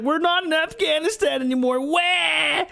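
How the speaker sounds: high, hurried fake bawling; mock crying. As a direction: wail it like a mocked crybaby. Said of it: "that's not why he's crying. He's like".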